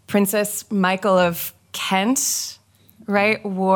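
slow, drawn-out American accent. Real, not acted. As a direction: put on an American accent for the name. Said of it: "Careful with the American accent".

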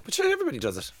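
posh mock voice.

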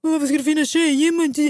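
lightly voiced complaining gym guy; a hint of a whining man; mock whiny guys. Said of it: "killar som har klagat".

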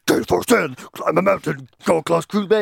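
a lightly hammed-up tough-guy voiceover; joke macho voice. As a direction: ham up a macho announcer as a joke. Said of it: "I did it as a joke".

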